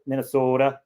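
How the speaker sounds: "Minnesota" with a long, round o; Minnesota accent. Retold or imitated. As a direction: stretch the o in "Minnesota", mock Minnesotan. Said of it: "most annoying American accent".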